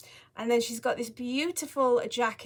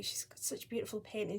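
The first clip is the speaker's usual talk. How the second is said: whispering; 9 dB quieter than usual. Real, not acted. Admiring the doll hushed.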